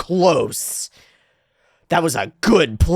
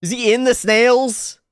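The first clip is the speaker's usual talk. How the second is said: ordinary talk, nothing marked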